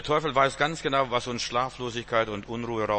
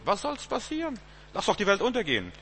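carefree defiance; 10 semitones higher than usual. Acted. Acts carefree defiance of doom.

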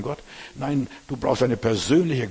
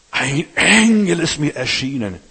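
loud, slow, higher mock-solemn claim; mocking old prophet; 4 semitones higher, 7 dB louder, slower than usual. Preacher mimicking the old prophet luring the young one, loud and mocking. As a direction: ham up the old fraud's grand announcement. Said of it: "was der alte Prophet, dieser alte Knacker macht?".

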